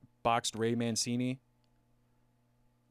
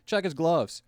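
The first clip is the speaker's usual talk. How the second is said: raised, higher-pitched demand; mock call-out; 7 semitones higher, 9 dB louder, slower than usual. Boxer demanding a glove check, mock call-out. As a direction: call it out as an accusation.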